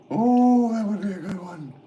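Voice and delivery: thrilled, voice shooting way up; excited delight. Real, not acted. Streamer delighted by his Halloween costume idea, high and excited.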